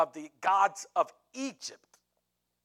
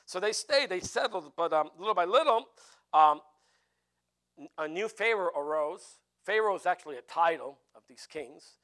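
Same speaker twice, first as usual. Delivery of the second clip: usual speaking voice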